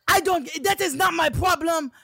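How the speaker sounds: high, loud mock shout; imitating a screaming driver. Driver screaming at his rider, high loud mock shout. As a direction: yell it like an angry cabbie refusing. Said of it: "And he is screaming at me".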